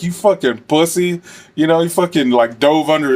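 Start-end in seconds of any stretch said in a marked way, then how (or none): 0.0-1.2 mocking taunt. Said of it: "I was laughing".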